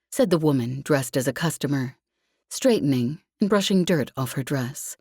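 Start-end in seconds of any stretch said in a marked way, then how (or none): none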